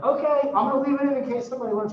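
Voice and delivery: high, loud, sing-song defiance; defiant mock cheer. Lecturer replaying her cheeky reply to a colleague, high and loud. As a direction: brightly shrug off the colleague's order. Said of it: "so I was like".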